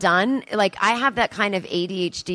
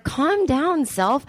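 mock yell; 7 semitones higher, much slower than usual. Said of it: "I'm just yelling at myself".

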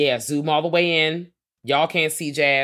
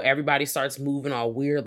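ordinary talk

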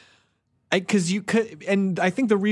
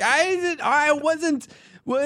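very high, stammering mock defence; comically flustered squeak; flustered protest; 11 semitones higher, slower than usual. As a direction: sputter it high, caught out and helpless. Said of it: "And you're like".

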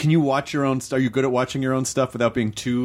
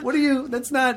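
mock bafflement; 11 semitones higher than usual. Mock baffled crew reaction.

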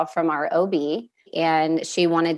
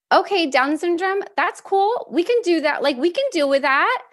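much higher, bright and breezy, brushing it off; upbeat retold reaction; 12 semitones higher than usual. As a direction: chirp it out like it's no big deal. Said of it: "my exact words still to this day were".

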